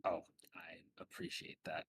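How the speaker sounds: hushed, breathy thank-you; whispered thanks. Acted. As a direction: whisper it so no one hears. Secret palmed tip, whispered thanks.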